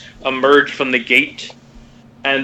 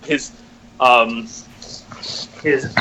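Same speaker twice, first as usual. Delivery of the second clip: ordinary talk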